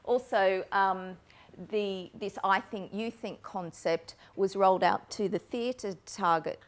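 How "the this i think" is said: usual speaking voice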